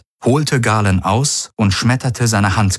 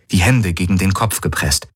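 plain reading voice

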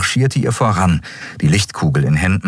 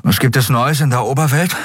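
mouth full; 8 semitones higher than usual. A narrator's reading. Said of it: "fragte er kauend".

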